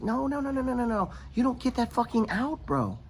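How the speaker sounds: high, exasperated no-no-no; mock his own disbelief at them; incredulous retort. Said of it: "well, it's like".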